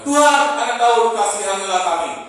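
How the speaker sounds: loud, high pleading cry. A narrator's reading. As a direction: shout for mercy over the crowd. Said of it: "makin keras berseru".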